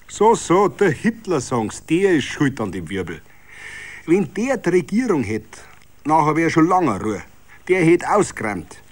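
a hint of a smug tavern braggart; boastful regular. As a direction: crow it with confidence. Steutzinger praising Hitler.

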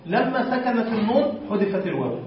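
ordinary delivery

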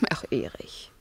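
a soft, voiceless sigh of his name; sighing whisper. Acted. Wife sighs her husband's name in a whisper.

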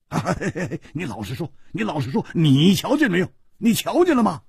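越问越急，声音拔高变响; 抓住对方急着逼问; 急切追问. Acted. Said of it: "他抓着楚留香说". (胡铁花抓着楚留香急切追问)

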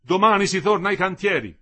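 high, loud shout; shouted announcement. A narrator's reading. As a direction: shout it out to the crowd. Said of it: "aveva gridato Lindo".

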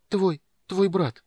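high, slow, halting babble; flustered stammer. A narrator's reading. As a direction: stammer it out in confusion. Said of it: "в замешательстве пролепетала Эмберил". (растерянный лепет)

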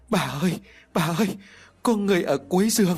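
put-on high, urgent child's cry, rising; frightened child. A narrator's reading. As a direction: call out to grandma in fright. Terrified child calls grandmother.

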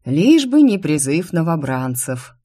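plain reading voice

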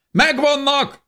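high, loud soldier's shout; triumphant shout. A narrator's reading. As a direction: call out the find. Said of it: "kiáltott az egyik férfi".

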